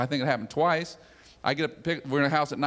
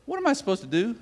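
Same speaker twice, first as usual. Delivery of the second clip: baffled; 6 semitones higher than usual. Retold or imitated. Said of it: "say to yourself".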